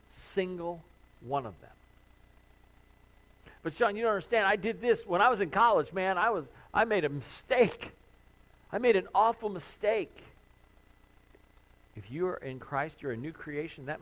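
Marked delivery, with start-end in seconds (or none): 3.6-10.1 a hint of a distressed listener protesting; pleading objection. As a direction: voice a guilt-ridden listener arguing back, louder and higher.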